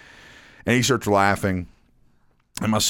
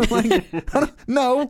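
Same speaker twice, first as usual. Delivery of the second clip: very high, giggly kid impression; giggling nephew; 14 semitones higher than usual. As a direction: deny it in a squeaky mock child's voice. Imitates giggling nephew denying it.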